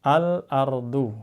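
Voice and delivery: al-ardu drawn out slowly in recitation voice; Quranic recitation. Shown as a demonstration. Teacher showing students how al-ardu is read, slow recitation.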